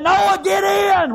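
high, drawn-out preacher's shout; slow, soaring holler; shouted command. Acted. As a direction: call Noah in, loud and stretched long. God's command repeated as a shout.

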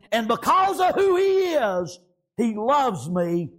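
high and loud, slowing; preaching lift. Acted. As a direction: build then let it settle. Preaching climax winding.

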